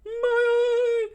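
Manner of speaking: loud, very high falsetto; hammed-up high yelp; mock pained shriek. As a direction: squeal in cartoon pain. Struck fighter cries out.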